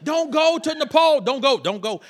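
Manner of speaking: hammed-up prayer-meeting panic; dramatic intercessors. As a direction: plead melodramatically, imitating them. Acts out intercessors' dramatic warnings.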